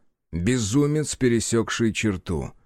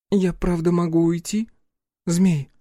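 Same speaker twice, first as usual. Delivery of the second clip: put-on crushed girl; 6 semitones higher than usual. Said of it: "словно девушка буквально раздавлена".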